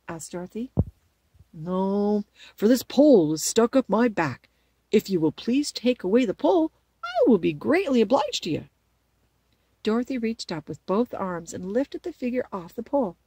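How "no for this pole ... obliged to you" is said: loud, earnest scarecrow request; pleading scarecrow. Acted. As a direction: ask a big favour as a scarecrow. Scarecrow asks for help.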